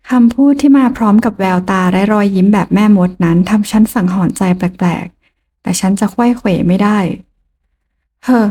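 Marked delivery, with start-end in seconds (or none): none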